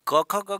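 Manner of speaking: क, ख, ग called out high and loud; letter recital. Shown as a demonstration. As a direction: chant क, ख, ग loudly like a class drill. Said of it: "ऐसे ही पढ़ते हैं।".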